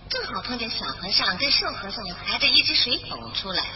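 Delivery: plain reading voice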